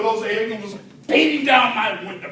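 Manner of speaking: ordinary delivery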